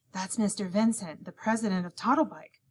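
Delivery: plain reading voice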